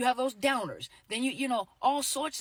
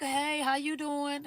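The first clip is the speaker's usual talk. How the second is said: slow, higher, drawn-out "how you doing"; probing greeting; 5 semitones higher, slower than usual. Shown as a demonstration. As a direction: fish for a reaction with a sweet hello.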